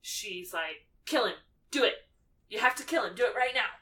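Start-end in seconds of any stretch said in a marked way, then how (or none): 1.1-3.7 high, loud, urgent villainess commands; acted frantic goading, raised pitch; crazy Bellatrix. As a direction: egg them on in short, pushy bursts. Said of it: "being crazy pants".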